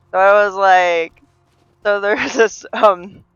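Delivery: normal talking voice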